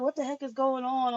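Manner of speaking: high, bewildered question; retold bewilderment. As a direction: ask it like you just woke up lost.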